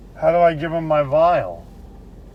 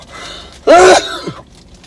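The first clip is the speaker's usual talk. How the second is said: coughing; 9 dB louder than usual. Real, not acted. Coughing after the nose swab.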